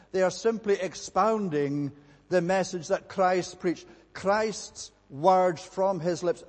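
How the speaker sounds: preacher pressing home Christ's final word; rising sermon fervour. Acted. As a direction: drive the point home from the pulpit. Preaching builds in force.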